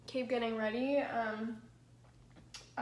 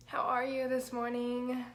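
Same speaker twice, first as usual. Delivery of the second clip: usual speaking voice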